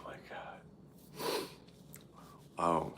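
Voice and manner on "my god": whispered. Real, not acted. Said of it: "laughing at a chat comment".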